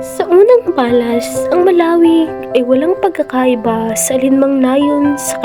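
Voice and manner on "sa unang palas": ordinary talk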